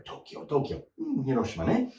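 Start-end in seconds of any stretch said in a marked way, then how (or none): 0.0-0.8 Tokyo whispered without voice. Shown as a demonstration. Teacher whispering Tokyo to students as an aside. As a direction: breathe out Tokyo, Tokyo under the breath.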